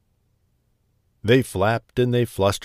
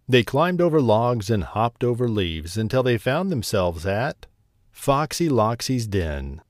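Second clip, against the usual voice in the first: plain reading voice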